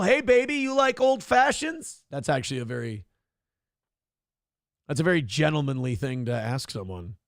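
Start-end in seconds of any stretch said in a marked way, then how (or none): 0.0-2.0 high, rising hollered offer; catcall. Acted. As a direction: call it out high, slowing on the drink. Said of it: "Aaron cat call".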